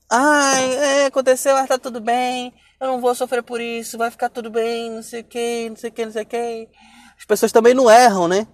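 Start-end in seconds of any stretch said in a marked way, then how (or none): 0.1-6.7 mock breezy reassurance, dragging into a singsong; fingindo que está tudo bem. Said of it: "Quando a gente vê as pessoas no Instagram". (imitação debochada de quem finge estar bem)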